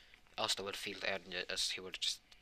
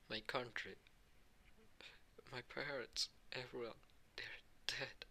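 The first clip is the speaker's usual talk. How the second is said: crying; 4 semitones higher, 10 dB quieter, slower than usual. Acted. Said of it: "you start to cry now".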